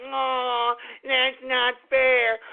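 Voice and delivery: slow, drawn-out mock whine; exaggerated pouting imitation; mocking upset listeners. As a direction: mimic a sulky complainer, stretching every word. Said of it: "everybody's all upset and all angry, like".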